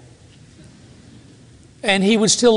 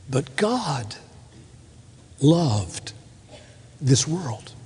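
"But God" "loved" "this world" nearly whispered, much softer, with long pauses; hushed emphasis; 3 semitones lower, 8 dB quieter, much slower than usual. Shown as a demonstration. Pastor letting the verse sink in for the congregation, hushed and slow. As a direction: drop to a reverent hush on "loved" and "this world". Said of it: "put an emphasis on a different word".

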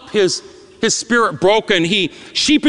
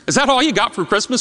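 ordinary talk

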